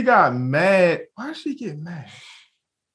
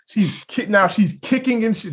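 usual speaking voice

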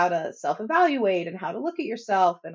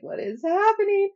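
high, louder mock disbelief; surprised; 12 semitones higher, 5 dB louder than usual. Kids startled by mother's calmer responses, mock surprise. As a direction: voice bewildered kids, pitch leaping up. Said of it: "the look of surprise".

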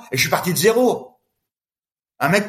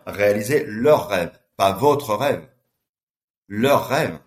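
"leur" slow and heavy each time, set against "votre"; "leur rêve" drawn out and weighted; stress on leur; 6 semitones lower, much slower than usual. Shown as a demonstration. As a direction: lean hard on "leur", slowly. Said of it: "tu viens de le dire".

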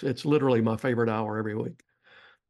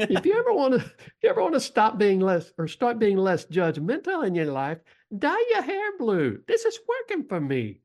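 put-on Lisa's accent; 9 semitones higher than usual. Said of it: "I'm gonna do this in Lisa's accent".

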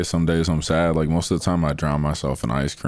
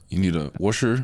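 Michigan accent; 3 semitones higher than usual. Shown as a demonstration. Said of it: "what they say, washer".

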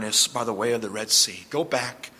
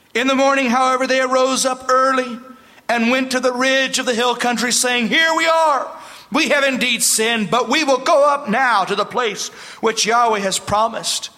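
reading the verse again much louder and higher; booming pulpit reading of "Here we are. We have indeed sinned"; loud emphatic reading; 6 semitones higher, 4 dB louder than usual. Shown as a demonstration. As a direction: proclaim "In the morning, however" with force. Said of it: "rereads the same verse louder".

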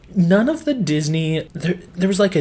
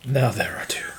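whispering; 9 semitones higher, 5 dB quieter than usual. Real, not acted. Whispered joke, then laugh.

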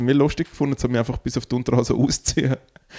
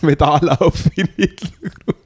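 laughing; 6 semitones higher than usual. Real, not acted. Joking about the Hitler salute.

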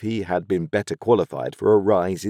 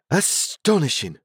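astonished; 10 semitones higher than usual. A narrator's reading. Joe marvels at Pip.

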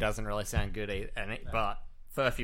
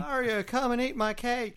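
high, slow, sing-song cartoon voice; a high, slow put-on voice calling Mario to eat the cake; put-on character voice; 13 semitones higher, slower than usual. Jokingly voicing a character inviting Mario to cake, in a high, slow put-on voice. Joking about who made what.

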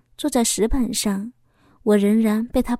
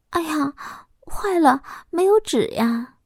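higher-pitched, sheepish little fluster; embarrassed; 5 semitones higher than usual. A narrator's reading. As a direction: sound a bit embarrassed at being caught short. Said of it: "有点不好意思地说道".

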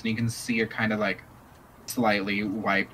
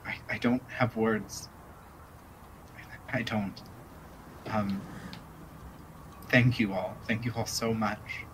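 choked-up thanks; 9 dB quieter than usual. Acted. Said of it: "Euphemia looks up and says".

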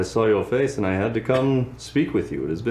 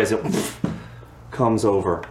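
ordinary delivery

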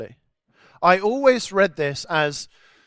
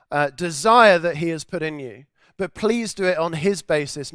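normal talking voice

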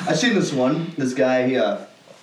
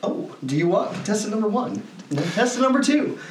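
high, teasing game show host; flirty teasing dating host; 7 semitones higher than usual. Retold or imitated. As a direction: tease the crowd like a dating host. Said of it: "that one dating show".

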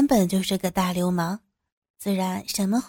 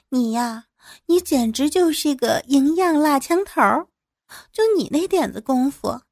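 higher-pitched, mocking comeback; brazen teasing woman; 5 semitones higher than usual. Woman mocking a drunk man's boast, brazen teasing. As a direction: taunt him playfully in a higher voice.

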